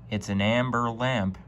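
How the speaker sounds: ordinary delivery, nothing marked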